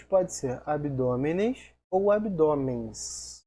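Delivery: slow, clear "abdômenes ou abdômens" with "ou" stressed; slow contrast. Shown as a demonstration. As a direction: spell out both forms slowly. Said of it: "vai ter duas possibilidades". (devagar, destacando "abdômenes ou abdômens")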